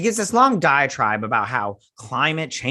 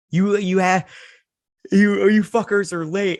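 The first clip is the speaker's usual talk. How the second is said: angry; 7 semitones higher, 5 dB louder than usual. Retold or imitated. Character scolds the heroes.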